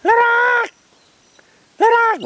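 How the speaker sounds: very high drawn-out screech. Retold or imitated. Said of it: "you can hear many of them go".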